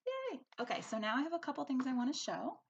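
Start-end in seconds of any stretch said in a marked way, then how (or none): 0.1-0.4 high, light cheer. Real, not acted. Teacher finishing the story, high light cheer. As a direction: celebrate the ending. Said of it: "cheering after story".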